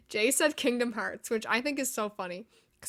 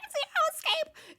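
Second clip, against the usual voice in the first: excited shriek; 4 dB louder than usual. Retold or imitated. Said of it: "I was like".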